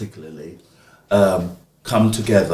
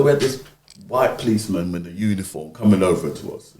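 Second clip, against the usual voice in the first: ordinary delivery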